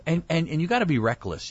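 usual speaking voice

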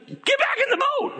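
high, laughing panicked squeal; panicked yelp. Acted. Disciples shriek at the charging naked man, in high laughing panic. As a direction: squeal it in mock terror, half laughing.

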